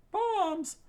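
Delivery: very high, loud shriek; screamed warning. Acted. Chef warns as bombs fall, screaming. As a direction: scream the alarm in one burst. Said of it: "the chef screamed out".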